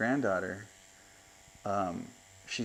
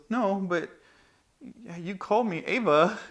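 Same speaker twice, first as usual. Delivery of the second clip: put-on high, louder child's protest; imitated granddaughter; 10 semitones higher, 7 dB louder than usual. Grandpa acting out his granddaughter's complaint, high protesting child. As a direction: protest like a hurt little girl, pitched up. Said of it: "So she goes".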